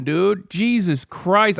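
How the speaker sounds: ordinary talk, nothing marked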